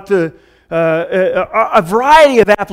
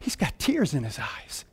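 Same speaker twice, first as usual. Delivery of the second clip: hushed confiding; 6 semitones lower, 17 dB quieter than usual. Retold or imitated. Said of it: "He says".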